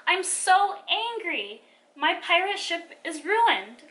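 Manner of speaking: loud, high, drawn-out outburst; angry yell. A narrator's reading. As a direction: yell it slowly, hitting each stressed word. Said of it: "and yelled".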